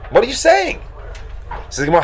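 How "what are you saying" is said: high-pitched incredulous question; astonished, rising at the end; shocked. Acted. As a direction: ask it in acted disbelief. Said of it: "he's shocked".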